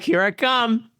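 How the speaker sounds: high, mock dying old man; hammed-up Redd Foxx heart attack; lightly voiced Redd Foxx clutching his chest; Red Fox. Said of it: "I'm like Red Fox".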